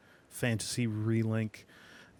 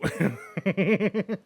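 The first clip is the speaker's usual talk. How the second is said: bright high laugh; high giggle; 8 semitones higher, 4 dB louder than usual. Real, not acted. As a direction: giggle at own joke. Laugh after intrigued ooh.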